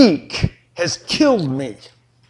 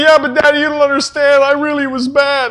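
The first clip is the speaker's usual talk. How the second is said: put-on high, pleading kid voice; a child begging Daddy to punish him; whiny child; 7 semitones higher, 3 dB louder than usual.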